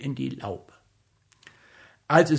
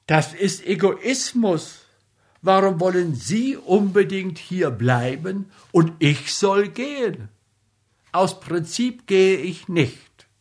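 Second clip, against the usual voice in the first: put-on young student voice; 6 semitones higher than usual. Mitja voiced in the story.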